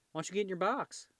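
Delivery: high, lilting pet-talk question; talking to a cat. Real, not acted. Owner urges the cat into the box, pet-talk voice.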